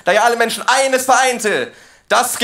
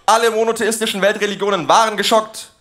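ordinary talk, nothing marked

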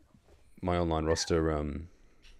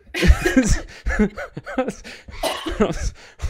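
laughing; 7 dB louder than usual. Real, not acted. Laughing at the mix-up.

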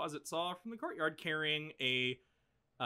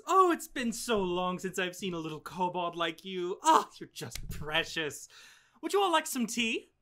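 higher, fussing grandmotherly voice, lightly done; doting old hostess; 6 semitones higher, 5 dB louder than usual. Acted. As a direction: fuss over the guests like a doting grandma. GM voices Ruth doting on kobold.

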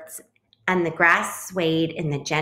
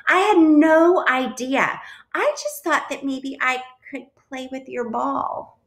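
apologetic little bird; 7 semitones higher than usual. A narrator's reading. Bluebird explains to the parents, apologetic little voice. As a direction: start emphatic, then shrink into a small high explanation. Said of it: "apologized the bluebird".

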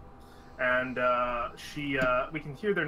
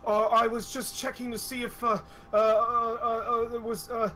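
higher, nervous stammering excuse; flustered high stammer; 8 semitones higher, 4 dB louder than usual. Acted. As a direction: caught red-handed, fumble words. NPC caught, flustered stammer.

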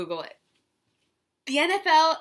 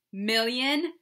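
ordinary delivery

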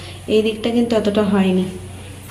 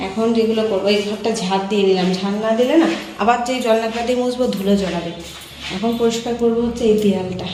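normal talking voice

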